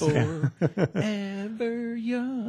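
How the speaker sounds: high slow cracking sung line; crooning, voice breaking up high. Real, not acted. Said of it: "I think my voice just cracked".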